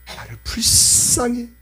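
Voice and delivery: normal talking voice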